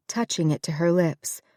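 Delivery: usual speaking voice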